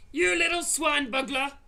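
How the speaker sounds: loud, high giant insult; furious roar. A narrator's reading. Blood bottler raging at the BFG. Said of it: "roared the blood bottler".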